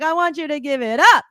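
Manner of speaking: imitating the DJ demanding applause. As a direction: push the crowd to clap. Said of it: "He's like".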